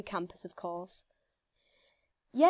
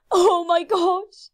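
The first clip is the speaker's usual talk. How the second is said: excited; 5 semitones higher, 7 dB louder, slower than usual. Real, not acted. Nervously waiting for the level.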